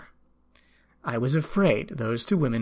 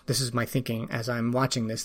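usual speaking voice

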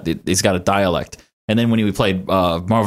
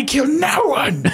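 high, slow mock declaration; exaggerated impression voice, pitched way up; put-on tough guy; slower than usual. Mock impression after Bronson suggestion.

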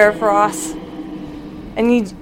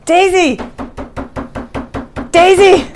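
panicked shout for a friend; frantic calling; 11 semitones higher than usual. Acted. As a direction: yell her name through the door. Banging on door, calling out.

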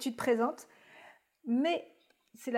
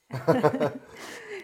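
low laugh; 6 semitones lower, 6 dB louder than usual. Real, not acted. Host laughs after backing Adrien.

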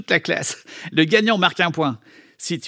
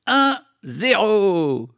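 smug gloat; 4 semitones higher, much slower than usual. Acted. Bully gloats over score.

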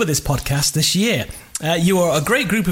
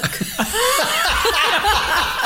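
high squealing laugh; high burst of laughter. Real, not acted. Host hearing upper bollock, high helpless laugh.

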